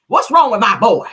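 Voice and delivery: loud, high mock country mother; Marlene impression. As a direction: holler it like a country mama. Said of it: "That's what she like to say".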